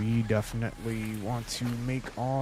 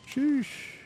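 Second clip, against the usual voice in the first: excited. Real, not acted. Reacting to the water.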